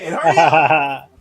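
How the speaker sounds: loud, slow yell. Acted. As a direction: bark it out slowly. Bowser yelling at son.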